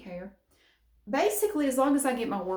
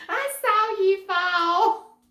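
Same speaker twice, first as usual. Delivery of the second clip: high, loud, drawn-out mock cackling call; retold laughing shout; 11 semitones higher, 9 dB louder, much slower than usual. Re-enacting herself on the fall tape, retold laughing shout. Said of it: "me laughing like hell in the background".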